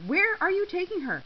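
high-pitched, anxious demand; frantic. A narrator's reading. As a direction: call frantically after a friend being taken. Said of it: "Natalie asked frantically".

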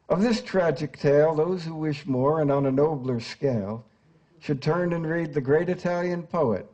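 poem recitation. Acted. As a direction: recite the stanza like a dramatic reading. Reads Chaucer stanza aloud.

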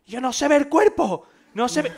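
high, loud, slow panicky wail; panicked friend. Retold or imitated. Friend at the window wails in panic, high and loud.

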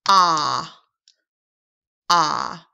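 loud, drawn-out open "ah" sound; teacher modeling the short o "ah"; vowel sound ah. Shown as a demonstration. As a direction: sound out "ah" clearly for the learner.

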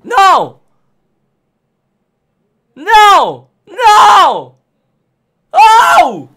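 real dismayed shout. Real, not acted. Streamer shouting as his subscribers drop, dismayed. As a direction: yell no at the screen.

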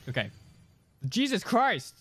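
normal talking voice

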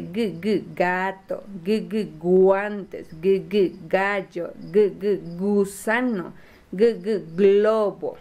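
"g, g" sounded separately as hard g before each word; sounding out initials. Shown as a demonstration. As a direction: repeat the hard g, then stretch the word. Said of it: "identifique el sonido inicial de una palabra".

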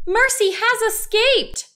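high-pitched announcement; excited cry. A narrator's reading. Wife seeing the pig hop off, high excited voice. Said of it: "said Missus Watson".

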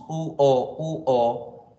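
"U-O" sounded out vowel by vowel; vowels spelled out. Shown as a demonstration. Teacher sounding out vowel sounds for students. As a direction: glide from "U" into "O" slowly. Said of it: "Hay que decir una U".

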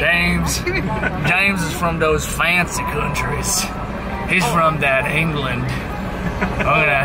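low-pitched Texan drawl; Texan accent. Shown as a demonstration. Showing a friend what a Texan sounds like, Texan accent. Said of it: "That's my Texan accent".

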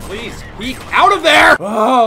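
loud, high, slow desperate shout; pleading shout. Real, not acted. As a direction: beg the enemy to step out. Begging enemy to peek.

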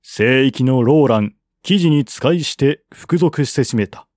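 ordinary delivery

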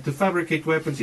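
ordinary talk

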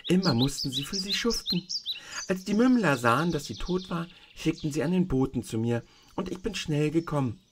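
a hint of a kindly tiny old woman; old witch of the North. The tiny old woman shows Dorothy the feet, gently voiced.

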